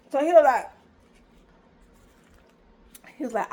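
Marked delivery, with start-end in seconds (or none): none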